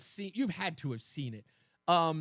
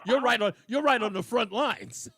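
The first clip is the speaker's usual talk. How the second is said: laughing tease; 4 semitones higher, 6 dB louder than usual. Real, not acted. Teasing caller about Canada.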